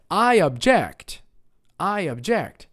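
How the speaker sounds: lawyer's objection, modelled as obJECT; second-syllable stress. Acted. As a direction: stress JECT in "object" with a high "I". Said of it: "a lawyer in a courtroom will say".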